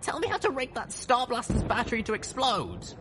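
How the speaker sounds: very high-pitched, urgent order. A narrator's reading. Rescuer to the colonel about the battery, ordering. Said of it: "I ordered".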